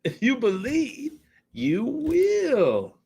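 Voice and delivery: high, slow dramatic pitchman; commercial slogan voice. Retold or imitated. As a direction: sell the line like an ad.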